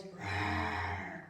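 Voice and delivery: low, growled rawr; be the bear scaring the boy; polar bear growl. Acted. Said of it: "the polar bear goes".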